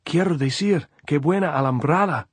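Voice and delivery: high, loud call; shouting. A narrator's reading. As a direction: shout it across the wire. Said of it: "Andres shouted".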